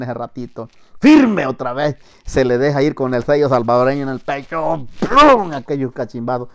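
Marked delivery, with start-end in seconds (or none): 1.0-1.5 high, loud barked order; sergeant's command. Retold or imitated. Sergeant ordering the corporal to attention, shouted command. 5.0-5.5 high, loud thud effect; imitated blow. Storyteller imitating a boot hitting the chest, loud impact noise.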